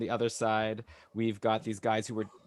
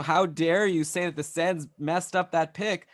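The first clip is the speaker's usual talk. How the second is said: higher, playfully outraged fan; mock indignation; 6 semitones higher, 4 dB louder than usual. Host speaking for a frowning Sens fan, mock indignation. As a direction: tease with put-on outrage.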